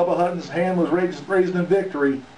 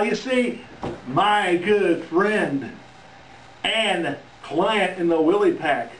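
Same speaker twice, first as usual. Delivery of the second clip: ordinary talk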